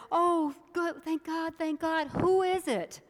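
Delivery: high, loud, eager relief; relieved excitement. Acted. Hanging man hearing God answer, high excited relief. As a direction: gasp it out, rescued at last.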